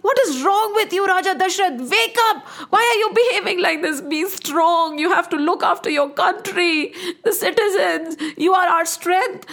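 high, forceful scolding to rouse him; urgent rebuke. Acted. Queen rebukes the raving king.

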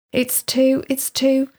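high, halting mock fluster; fumbling for words. A narrator's reading. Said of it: "she fumbled for words".

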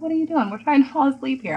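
high, fast, exasperated complaint; mock a sleepy husband's irritation; annoyed husband.

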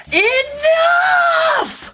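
huge, high, loud scream; screaming Enough. Acted. Intimidating a whole room of people, a huge scream. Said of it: "just screaming".

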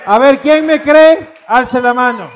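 slow, loud call to the room; rally the audience, slow and loud; loud slow show of hands call; calling out to the crowd. Real, not acted.

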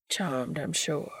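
slow, low English drawl; English accent. A narrator's reading. Crowley carelessly shakes Dean's hand, slow English drawl. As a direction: draw it out lazily in an English accent. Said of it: "annoying English accent over there".

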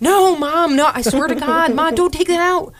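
high pleading protest; pleading kids. Retold or imitated. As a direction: beg your mom not to.

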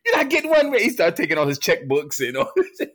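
hammed-up stubborn father; mock rant. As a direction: go off about the money. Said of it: "Then he goes off again".

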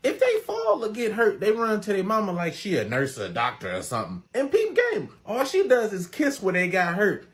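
normal talking voice

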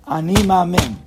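loud, high, decisive declaration; bold, ringing affirmation; firm conviction. Shown as a demonstration. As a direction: proclaim faith without a doubt. Demonstrating declaration of faith with conviction.

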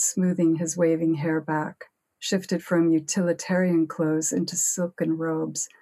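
usual speaking voice

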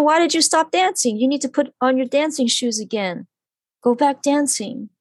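high, light voice for the mother's message; relaying the medium. Retold or imitated. Medium passing on a dead mother's words, in a high voice. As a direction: lifts her pitch well up to pass on the mother's words. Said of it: "And she said".

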